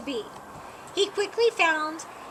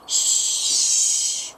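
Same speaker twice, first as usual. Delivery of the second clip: sharp hushing shh; shushing; 4 dB louder than usual. A narrator's reading. A stranger hushes loud Bear, sharp shh. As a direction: hush someone from behind the shelf. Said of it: "said a voice".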